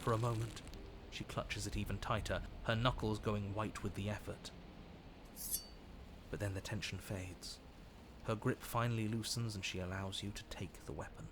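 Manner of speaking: calm low storytelling voice; story narration. A narrator's reading. Narrates the game scene.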